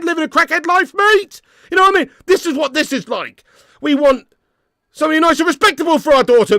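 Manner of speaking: loud and high, getting worked up; heated, rising rant. Real, not acted. Fan comparing United to a daughter dating a crackhead, heated.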